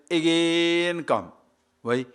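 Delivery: AGAIN drawn way out; exasperated retelling. Retold or imitated. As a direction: stretch out "again" in mock weariness. Retells repeated official summons with exasperation.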